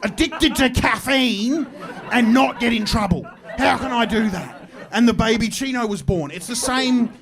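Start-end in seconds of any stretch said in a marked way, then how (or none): 0.0-4.5 higher, louder ranting evil marketer, slowing to a drawn-out scheme; an evil genius scheming in a cafe, half-shouting; scheming marketing exec. Retold or imitated. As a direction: ham up a greedy villain plotting over babies. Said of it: "looking at the mums ... and going".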